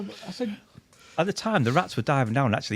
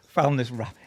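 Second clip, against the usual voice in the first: usual speaking voice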